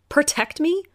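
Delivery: high, rising echo of his words; disbelieving echo rising at the end; echoing in disbelief. Acted. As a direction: repeat it back, stunned. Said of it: "I echoed".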